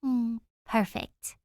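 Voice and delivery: slow, sighing mm of pleasure; contented sigh. Acted. Danny sipping tea, contented sigh. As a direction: savour it and sigh. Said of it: "she sighs".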